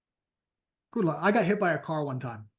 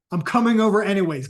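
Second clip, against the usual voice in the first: louder, flat-out refusal; stubborn insistence; 3 semitones higher, 7 dB louder than usual. Retold or imitated. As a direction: plant your feet and insist. Friend insisting again.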